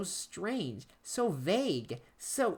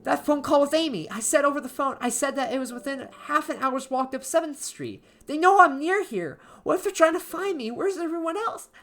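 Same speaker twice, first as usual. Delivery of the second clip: much higher and louder, frantic fear; rising panic; 9 semitones higher, 7 dB louder than usual. Acted. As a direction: spiral into terrified panic. Narrator realizes danger, panics.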